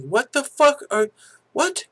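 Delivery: high, incredulous what the fuck; shocked disbelief. Retold or imitated. Telling the fighter he can't believe it, shocked high cry. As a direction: squeal out your disbelief.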